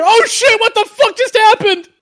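high squeaky startled deer; panicked deer voice. Retold or imitated. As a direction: squeal it like a deer just hit. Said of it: "you hear the deer".

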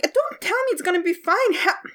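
high, snapping retort; hot-headed hunter snapping at her older partner; angry hunter. Acted. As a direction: bite back at the one calming her. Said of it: "She's like".

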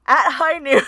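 giggling dramatic line; laughing through it. Pretend. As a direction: laugh as you say it. Joking western showdown line.

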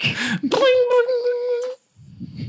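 very high chiming bling; ring sound effect. Pretend. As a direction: chime like game rings flying out. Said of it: "That's her getting hit".

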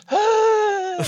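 high, loud mock gasp for air; imitated loud gasp. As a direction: act out a winded runner's gasp. Imitates her winded running.

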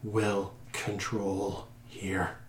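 slow, feeble, near-whispered voice; fading captive, words breathing out; weak voice. Acted. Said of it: "this weak voice".

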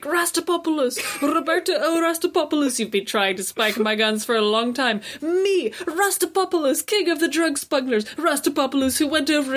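high, hammy villain boast; megalomaniac rant. Retold or imitated. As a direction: boast your own name grandly. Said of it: "a whole big speech of".

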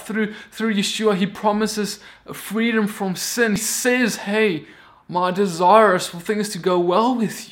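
loud, rising sermon delivery; fiery pulpit voice; preaching at full force. Acted. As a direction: preach it out to the congregation.

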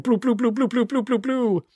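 high soft bloop sound effects; mock blaster bloops. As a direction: pop out each bloop like a toy gun. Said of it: "every P P G around is bloop".

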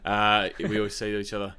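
usual speaking voice

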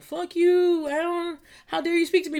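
high, snooty diva put-down; haughty diva. Retold or imitated. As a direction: sneer at a fan like a diva. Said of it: "Not because I'm like".

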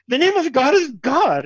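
high-pitched, mock-emphatic declaration; insistent Englishman. Englishman answering the Indian farmer, mock insistence. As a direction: insist on it as the comic English invader. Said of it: "And the Englishman says".

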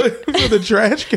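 high mock Australian accent, laughing; a laughing stab at an Australian accent. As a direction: echo it in a laughing Aussie voice. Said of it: "Australian accent".

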